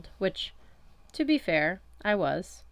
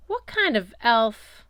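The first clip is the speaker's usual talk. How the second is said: slow, louder disgusted question; 5 semitones higher, 8 dB louder, slower than usual. A narrator's reading. Wildflower reacts to the deaf elf with slow disgust. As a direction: spit out the words in disgust. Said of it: "face scrunched up in disgust".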